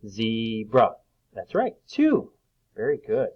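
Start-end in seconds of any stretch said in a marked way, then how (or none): 0.1-0.9 "zebra" stretched over two even beats; split into syllables. Shown as a demonstration. Teacher checking the count with children, "zebra" split in two. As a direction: separate both parts of "zebra". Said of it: "Does that have two syllables?".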